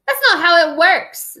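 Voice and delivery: lightly voiced cackling villain; cackling little man. A narrator's reading. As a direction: sneer it like a gleeful imp. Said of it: "the little man cackled".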